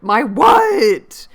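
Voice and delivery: loud, high-pitched incredulous yell; shocked outcry. Retold or imitated. Reader reacting to Rochester's unfinished sentence, shocked outcry. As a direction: burst out in hammed-up disbelief. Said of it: "And you're like".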